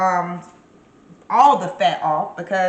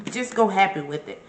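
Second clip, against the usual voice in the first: ordinary delivery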